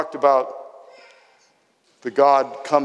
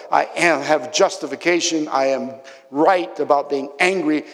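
louder, driving sermon delivery; raised preaching; 3 semitones higher, 3 dB louder than usual. Acted. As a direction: preach it out with rising force. Preaching Jonah's anger at full force.

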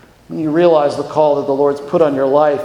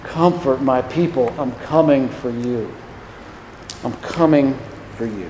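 low, soft, tender reassurance; God's comforting word, spoken low and softly; gentle comfort; 5 semitones lower, 6 dB quieter than usual. Acted. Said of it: "It will be a message of comfort".

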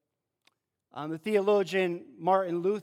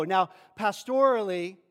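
ordinary talk

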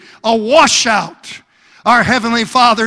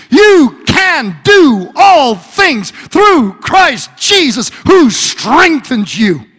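preaching at full force; 5 dB louder than usual. Acted. Preacher speaking God's promise to the church, full-force preaching. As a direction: proclaim it over the congregation. Rising sermon climax.

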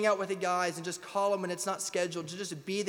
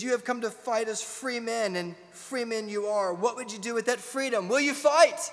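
William Wallace speech; 4 semitones higher, 6 dB louder than usual. Retold or imitated. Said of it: "He says".

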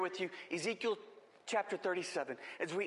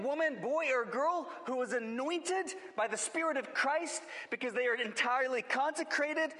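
preaching; 6 semitones higher than usual. Acted. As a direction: preach with full fervour. Preaching climax on anointing.

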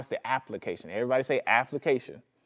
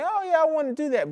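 mock tempted voice. Voicing temptation to sin.

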